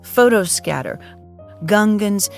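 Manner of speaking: ordinary delivery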